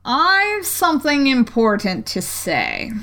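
slow, languid caterpillar. A narrator's reading. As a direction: drawl it out lazily. Caterpillar voiced in story.

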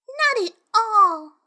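put-on high, slow, emphatic girl; young girl voice. A narrator's reading. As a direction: firmly say it like a child. Alice answers cat.

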